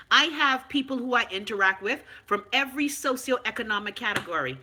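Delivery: ordinary delivery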